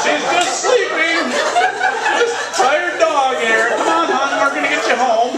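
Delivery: high, slow, sweet pet talk with a laugh under it; high doting voice covering for a dead dog; cooing to a pet. Pretend. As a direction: coo at the dog as if it's only asleep. Pretending the dead dog sleeps.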